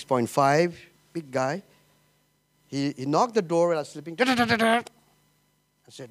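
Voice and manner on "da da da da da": imitated knocking. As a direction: tap out the knock on the door with your voice. Friend knocking on door.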